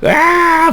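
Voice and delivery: high-pitched imitation of a father's outburst; mock angry yell. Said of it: "막 욕같이 화내실까".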